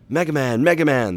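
high, loud, urgent calls; frantic doctor calling out; yelling. A narrator's reading. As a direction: yell for the hero over the radio. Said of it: "the doctor yelled".

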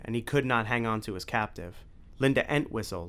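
usual speaking voice

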